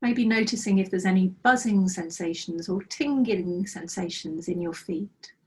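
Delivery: calm, unhurried meditation guide voice; guided meditation. Acted. Teacher guiding students through a breathing practice, calm meditation voice. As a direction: lead the students gently into body awareness.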